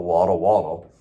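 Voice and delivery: ordinary delivery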